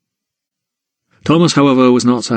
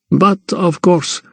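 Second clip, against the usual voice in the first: put-on slow, higher accented Count; explaining Count; 5 semitones higher, slower than usual. A narrator's reading. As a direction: explain slowly, with a raised voice pitch. Count's dialogue to Thomas.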